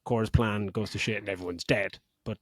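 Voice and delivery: ordinary delivery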